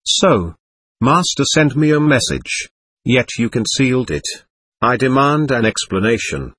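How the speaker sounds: usual speaking voice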